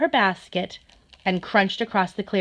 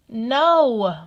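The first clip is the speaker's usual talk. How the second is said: moaning; 4 semitones higher, 5 dB louder than usual. Acted. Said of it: "moaned the fox".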